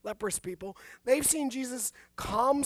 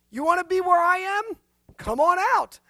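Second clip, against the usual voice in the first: eager invitation; 7 semitones higher, 9 dB louder than usual. Pretend. Jesus inviting Peter onto water.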